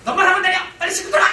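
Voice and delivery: 높고 크게 쏘아붙이는 엄마; 짜증 섞어 아이를 다그치듯 고함; critical mom yelling. Retold or imitated. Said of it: "근데 이렇게 나옵니다". (비판적인 엄마가 아이에게 버럭 소리치듯)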